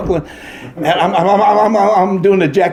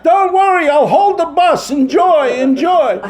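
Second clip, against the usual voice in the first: high, raised mock old-lady holler; high-pitched shout to the crowd; yelling back; 13 semitones higher, 3 dB louder than usual. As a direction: holler it back across the theater. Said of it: "she yells back".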